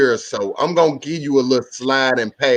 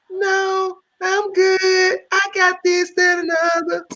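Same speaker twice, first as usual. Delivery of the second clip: high, flat falsetto lady voice; falsetto girlfriend voice. Retold or imitated. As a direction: float it up high like his girl.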